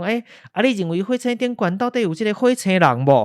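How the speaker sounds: a hint of a curious radio host; host asking a question. Radio host asking the scientist about Martians, lightly voiced. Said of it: "就问讲".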